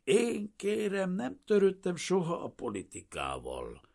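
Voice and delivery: high-pitched, defensive protest; insistent denial. A narrator's reading. Refugee telling curious roommates about himself, defensively insistent. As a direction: protest your innocence in a high voice. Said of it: "hajtogatta ilyenkor".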